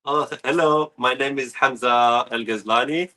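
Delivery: usual speaking voice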